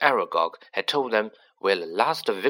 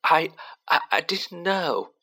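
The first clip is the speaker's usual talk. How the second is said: sobbing; 8 semitones higher than usual. Acted. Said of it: "sobbed Ginny".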